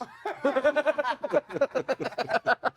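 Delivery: real burst of high laughter. Real, not acted. Storyteller cracks up at his own anecdote, laughing. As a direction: crack up after the reveal.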